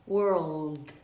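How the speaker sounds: world with a curled R, then L on the palate; American pronunciation. Shown as a demonstration. Teacher showing American world. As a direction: roll the tongue in world. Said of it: "enrolada na língua e falar world".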